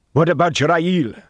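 high, loud challenge; agitated yell from a crowd; calling out. A narrator's reading. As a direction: shout the question from afar. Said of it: "another called".

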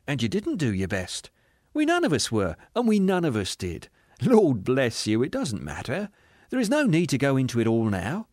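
lilting, forgiving candour; cheerful spirit. Acted. Spirit dismissing the ghost's claim, cheerfully gentle. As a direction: contradict him kindly and lightly.

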